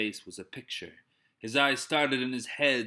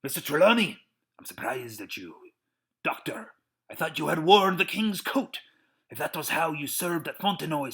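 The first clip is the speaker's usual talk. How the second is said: raised, scolding reproach; angry reproach; 5 semitones higher, 5 dB louder than usual. A narrator's reading. Captain rebuking his officers, angry and raised. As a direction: shame each man in turn. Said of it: "first time we had ever seen him angry".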